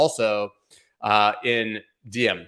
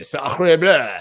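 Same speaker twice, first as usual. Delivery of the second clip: hammed-up French exclamation, higher and louder; mock French accent; 7 semitones higher, 7 dB louder than usual. Vlogger jokes about his French accent, exaggerated French cry. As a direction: blurt it out like a cartoon Frenchman. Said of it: "And then I would say".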